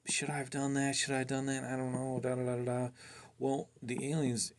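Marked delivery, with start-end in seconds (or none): 0.1-2.9 higher, doubtful inner voice, a touch of worry; muttering self-doubt, lightly voiced; second-guessing himself. The man doubting himself after giving up the device, higher and uneasy. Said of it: "he's thinking to himself".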